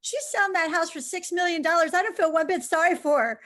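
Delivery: husband scoffing. Retold or imitated. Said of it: "And he says".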